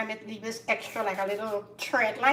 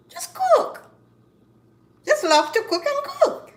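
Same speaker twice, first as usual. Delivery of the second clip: passionate plea; 7 dB louder than usual. Real, not acted. Passionate plea to stop fussing.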